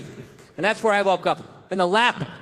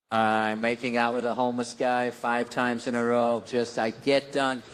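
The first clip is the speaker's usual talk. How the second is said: disgusted low aside; 9 semitones lower, 3 dB quieter than usual. Pretend. Gross-out punchline, low voice.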